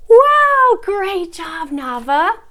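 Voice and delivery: high, loud wow easing down; delighted praise. Shown as a demonstration. Teacher praising student Nava, excited. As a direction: burst out cheering for a little learner.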